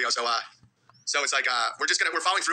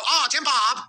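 high mock voice of a wife's mom; mother-in-law impression; 6 semitones higher, 3 dB louder, much slower than usual. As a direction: lightly imitate an older woman. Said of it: "That's his voice".

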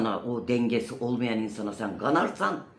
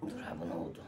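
hushed plea; 14 semitones higher, 12 dB quieter, much faster than usual. Retold or imitated. Retold frightened plea, unvoiced.

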